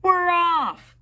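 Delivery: high, loud pirate cheer; crew shout. A narrator's reading. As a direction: yell it back as the crew. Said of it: "the crew shouted".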